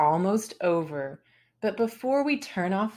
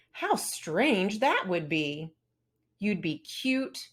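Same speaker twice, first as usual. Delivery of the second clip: plain reading voice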